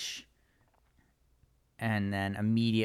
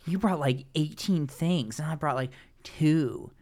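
snarky mockery; 3 semitones higher than usual. Husband belittling his wife over what she brought, snarky. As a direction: imitate someone sneering at a partner's belongings. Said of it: "snarking at her".

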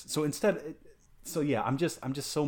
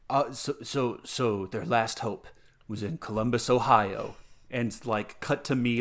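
ordinary talk, nothing marked

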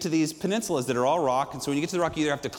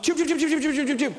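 imitated jumping sounds; 10 semitones higher, faster than usual. Friends following the brother off the cliff like lemmings, laughing. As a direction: mimic everyone leaping one by one, laughing. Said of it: "we all just went".

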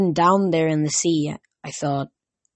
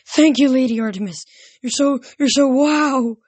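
high, loud starstruck babbling; gushing yammer; 8 semitones higher, 5 dB louder than usual. A narrator's reading. Grover kneels before the goddess Artemis, yammering in awe. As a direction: gush in awe, pitched right up. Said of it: "and started yammering".

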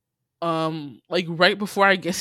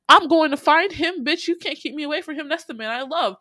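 defiant retort; 9 semitones higher than usual. Retold or imitated. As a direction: throw it back at her, defiant. Shauna quoted defiantly.

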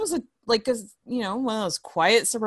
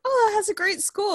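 impressed; 3 dB louder than usual. Retold or imitated. People mistaking her hometown.

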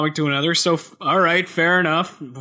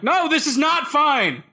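exasperated; 10 semitones higher than usual. Retold or imitated. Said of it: "I'm like".

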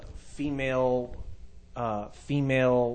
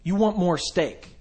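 excited; 7 semitones higher, 4 dB louder than usual. Real, not acted. Excited high pitch pitch.

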